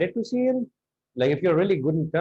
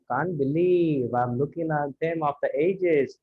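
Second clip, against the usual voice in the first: slow, drawn-out delivery without surprise; wrong, unsurprised tone; 2 semitones lower than usual. Shown as a demonstration. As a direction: drag the line out, missing the amazement. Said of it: "rather than".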